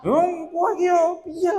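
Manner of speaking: high, slow scolding of a son; mock scolding parent. Teacher imitating a parent scolding the boy, high and slow. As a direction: scold like an exasperated parent, drawn out.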